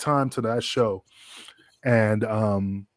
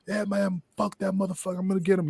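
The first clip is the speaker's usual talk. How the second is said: angry vow; 8 semitones higher, 3 dB quieter than usual. Retold or imitated. Jack voiced, angry.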